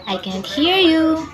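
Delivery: usual speaking voice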